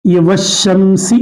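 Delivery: वशमसी read very slowly, व joined straight to श; slow recitation. Shown as a demonstration. Teacher slowly models वशमसी for the class. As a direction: say वशमसी slowly, skipping अलिफ and लाम. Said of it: "मिलाकर पढ़े तो अलिफ लाम नहीं पढ़ा जाएगा".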